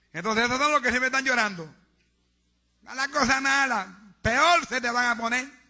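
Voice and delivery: fiery preacher denouncing tithe robbers; preaching at full force. Acted. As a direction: hammer every word home.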